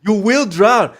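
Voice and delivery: high, loud repeated warning; loud and emphatic. Real, not acted. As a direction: drive the point home.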